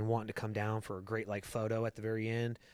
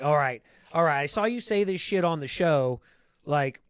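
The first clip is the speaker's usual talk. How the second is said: louder, higher, imitating mom's exasperated demand; imitating his mom snapping back, louder and higher; mock exasperated mom; 4 semitones higher, 8 dB louder than usual. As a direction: play his mom huffing back at her son. Son voices his mom's blunt reply.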